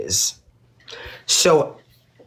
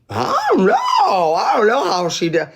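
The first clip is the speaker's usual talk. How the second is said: much higher, slow and drawn out, playing dumb; mock innocent denial; 6 semitones higher, slower than usual. To viewers, mocking Boston's claimed ignorance, high drawn-out innocent voice. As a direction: mimic her fake cluelessness, pitched way up and slow. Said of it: "And she acted like".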